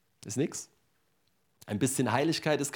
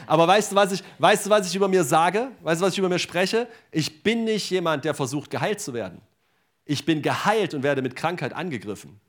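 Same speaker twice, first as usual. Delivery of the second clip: loud, high, driving preacher voice; full-force preaching; 3 semitones higher, 6 dB louder than usual. Acted. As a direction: preach it out to the whole hall.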